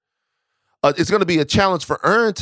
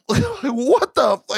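stunned disbelief; 11 semitones higher, much slower than usual. Retold or imitated. Retelling the shock, laughing.

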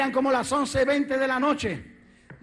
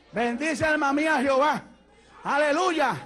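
usual speaking voice

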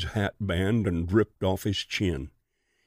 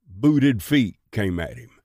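ordinary delivery, nothing marked

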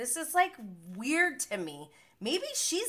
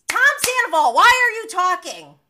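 very loud, high scolding shout; exasperated yell; 8 semitones higher, 14 dB louder than usual. Real, not acted. Host scolding Sandoval for jumping in, exasperated yell. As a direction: yell at him for butting in.

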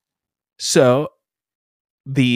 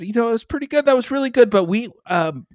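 higher-pitched, hesitant director; imitated director; 8 semitones higher, faster than usual. Director to auditioner after the scene, higher imitated voice. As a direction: let the director down them gently, pitched up. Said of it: "and the director said".

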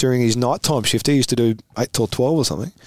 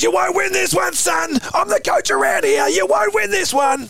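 high mock shouting coach; hammed-up high-pitched coach rant; coach yelling. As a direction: bellow it like a furious coach laying down the law. Coach storming in, retold.

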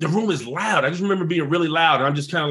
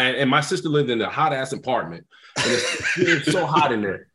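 ordinary delivery, nothing marked

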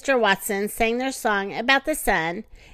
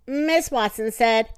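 plain reading voice

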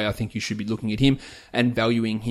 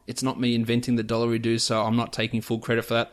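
ordinary talk, nothing marked

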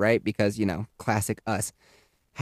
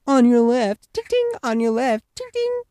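high sing-song bell and call; high mocking on your left with bell; mock passing cyclist; 11 semitones higher than usual. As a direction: mimic the smug rider ringing past. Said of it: "these geriatrics and the".

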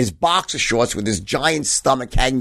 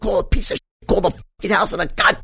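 higher, mock-enraged string of curses; cursing rant; 7 semitones higher than usual. Father cursing at whoever called, hammed-up rage. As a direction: chain the curses like art. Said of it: "my father could curse like fucking T S Eliot".

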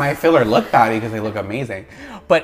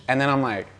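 ordinary delivery

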